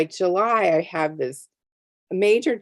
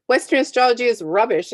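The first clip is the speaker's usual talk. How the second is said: quoting him, louder and higher, quick scornful verdict; loud dismissive retort; 7 semitones higher, 6 dB louder, faster than usual. Retold or imitated. Egyptologist dismissing Western astrology to the group, loud and scornful. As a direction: blurt out the put-down fast, mocking his certainty. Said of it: "He goes".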